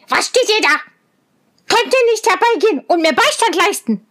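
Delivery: hohe, keifende Zwergenstimme; schreiender Zwerg. A narrator's reading. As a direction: schrill keifen wie ein wütender Zwerg. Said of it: "und schrie". (Zwerg schreit die Mädchen an, hoch und schnell)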